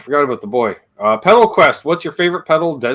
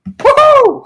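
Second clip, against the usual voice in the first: high, loud whoop of joy; excited cheer; 10 dB louder than usual. Real, not acted. Celebrating a close guess.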